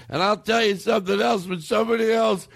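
put-on high ranting drunk; drunk uncle rant. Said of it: "I just remember hearing him".

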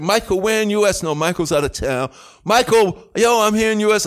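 calling out. Retold or imitated. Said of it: "So it was like".

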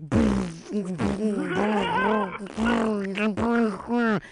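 imitated sputtering speaker crackle; broken speaker noise. Telling friends about the boat's broken radio, imitated speaker sputter. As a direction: buzz like a blown speaker. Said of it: "the speakers are broken".

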